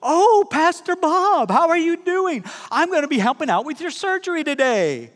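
sweet nurse voice. Retold or imitated. Masked nurse cheerfully greeting her pastor before surgery. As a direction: chirp a bright hello to the patient. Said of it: "She goes".

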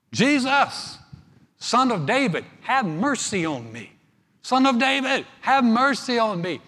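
loud, high cry for mercy; acted shout. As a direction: call out loudly over a crowd. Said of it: "he began to shout".

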